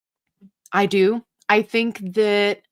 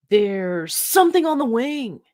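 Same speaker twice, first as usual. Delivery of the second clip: high, dramatic Shatner line; William Shatner impression; 4 semitones higher than usual. Host doing Shatner spotting the creature, hammed-up. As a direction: ham up the horror reveal. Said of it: "my best William Shatner".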